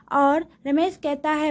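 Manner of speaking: ordinary delivery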